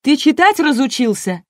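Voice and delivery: громкий и высокий злой упрёк; накинуться с упрёком на ведущего; разозлённо. A narrator's reading. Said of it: "разозлилась секретарь". (зло, громко и высоко)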